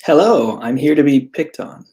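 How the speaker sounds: ordinary delivery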